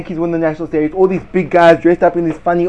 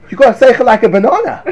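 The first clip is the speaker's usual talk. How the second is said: laughing it out; breaking into laughter mid-line; high laughing burst; 9 semitones higher, 4 dB louder than usual. Real, not acted.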